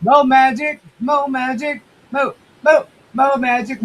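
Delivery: sing-song high calling out. Real, not acted. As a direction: call the name out brightly. Calling out a viewer's name.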